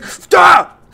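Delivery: sudden high, loud shout; alarmed yell. Real, not acted. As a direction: yell to get it off you. Grabbed in game.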